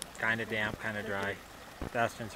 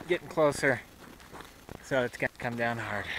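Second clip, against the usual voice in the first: ordinary delivery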